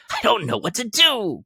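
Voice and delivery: teary giddy cry; laughing and crying. A narrator's reading. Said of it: "laughing and crying in the same breath".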